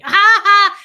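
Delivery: big loud shocked laugh, high; loud burst of laughter. Real, not acted. Streamer bursts out laughing at a viewer's comment, loud high laugh.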